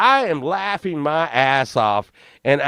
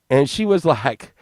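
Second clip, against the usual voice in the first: ordinary talk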